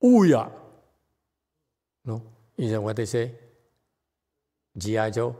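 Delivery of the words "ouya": short, high, loud battle cry; punchy rallying call; military cheer. Retold or imitated. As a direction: shout it like a drill-camp cheer.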